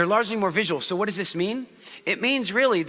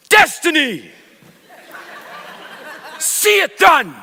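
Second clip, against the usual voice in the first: hyped visual shout; 8 semitones higher, 6 dB louder, slower than usual. Shown as a demonstration. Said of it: "louder, faster".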